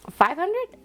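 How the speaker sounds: surprised, high questioning rise. Real, not acted. Vlogger hits an unexpected total while counting cash, surprised. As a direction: land on it in rising disbelief. Count exceeds what she expected.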